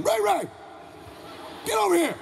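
high-pitched calling yell; Big Ray yelling. Retold or imitated. Father summons his son to the door, yelling. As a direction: holler for the boy, pitched high. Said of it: "That's how he yells".

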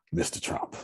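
breathy whispered aside; whispering. Real, not acted. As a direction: drop to a secret whisper.

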